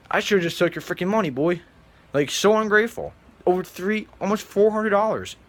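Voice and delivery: indignant, incredulous yelling; exasperated outburst. Real, not acted. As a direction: blow up at how ungrateful he was. Venting at ungrateful classmate.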